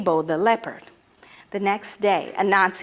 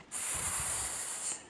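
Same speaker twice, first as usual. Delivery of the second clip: long unvoiced snake hiss; 22 dB quieter than usual. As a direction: hiss like a python. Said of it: "said Onini the python".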